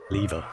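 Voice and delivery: "lever" said LEE-ver; LEE-ver, first vowel as in "leave"; pronounced "lever". Shown as a demonstration. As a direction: say "lever" with a long ee. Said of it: "It is pronounced le".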